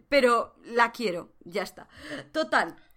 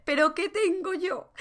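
mock Caitlyn; 7 semitones higher than usual. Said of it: "Pero dice".

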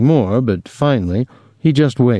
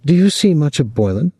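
higher, mildly probing question; lightly inquisitive; curious; 6 semitones higher, faster than usual. A narrator's reading. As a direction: ask with a touch of curiosity. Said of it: "she said curiously".

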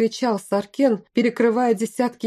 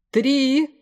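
high, drawn call from outside; called-out countdown; 7 semitones higher, 3 dB louder than usual. A narrator's reading. General outside counting down, calling it high. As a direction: call the count across a distance. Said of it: "раздался голос снаружи".

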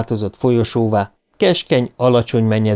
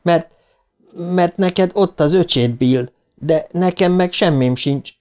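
put-on frightened boy; 7 semitones higher than usual. A narrator's reading. Shaken Stan pleads with Bill.

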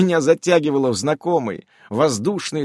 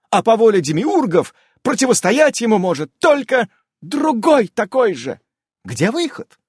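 high, loud and thrilled; enthusiastic; 12 semitones higher, 6 dB louder than usual. A narrator's reading. Amazon thrilled, recounting the battle, high and loud. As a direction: relive the battle with delight. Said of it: "восторженно продолжала Амалия".

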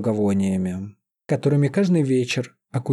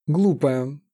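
plain reading voice

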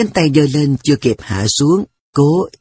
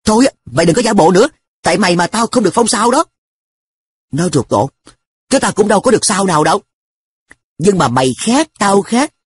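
mildly heated, higher-pitched reproach; sharp scolding; 6 semitones higher, faster than usual. A narrator's reading. Narrator blaming An for the lost award, sharp. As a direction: scold a friend who plays innocent.

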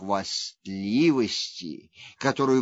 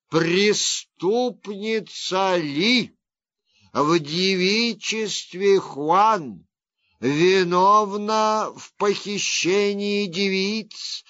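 raised, measured proclamation; formal verdict reading; 5 semitones higher, 4 dB louder than usual. Acted. Judge reads death sentence.

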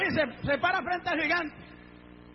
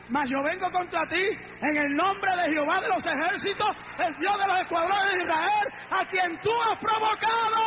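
defiance building to shout; 3 semitones higher than usual. Acted. Preacher voicing David challenging the giant, building to a shout. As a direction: build the challenge until the last words ring out.

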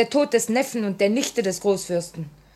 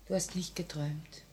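whisper; 3 semitones lower, 15 dB quieter than usual. Acted. Comforting Jannik after his confession.

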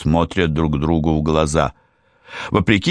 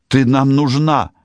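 desperate plea; 7 semitones higher, 3 dB louder than usual. A narrator's reading. Silka pleads with dying friend.

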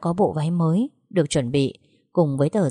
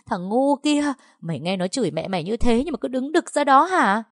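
higher, calling out to the whole street; outraged wailing; 6 semitones higher than usual. Acted. Mother calling the neighbours against her son, outraged. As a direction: wail for the neighbours to come and see.

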